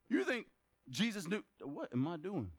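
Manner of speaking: usual speaking voice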